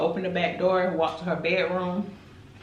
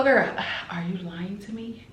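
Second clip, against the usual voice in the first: in character; 3 semitones higher than usual. Retold or imitated. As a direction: press the girl for the truth. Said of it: "I'm getting into character".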